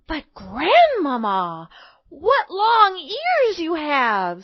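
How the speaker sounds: put-on slow, loud girlish wonder, rising high; astonished little girl. A narrator's reading. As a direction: marvel slowly as a small girl.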